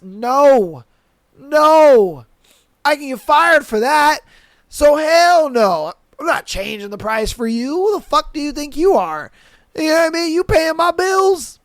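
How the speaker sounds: loud, high indignant ranting; angry. Real, not acted. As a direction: rant with outrage. Angry rant at customers.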